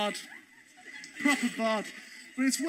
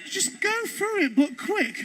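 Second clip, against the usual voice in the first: higher, disbelieving echo with a laugh; incredulous repeat; 6 semitones higher, slower than usual. Retold or imitated. Comedian repeats her advice back, incredulous and high. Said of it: "I was like".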